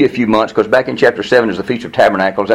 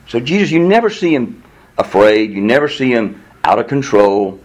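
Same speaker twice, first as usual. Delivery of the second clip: normal talking voice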